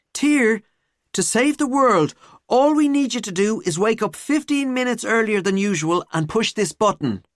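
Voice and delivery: high-pitched, pleading student; mock urgent plea. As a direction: plead as if the world hangs on it. Mock plea to save world.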